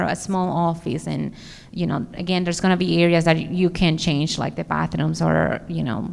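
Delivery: usual speaking voice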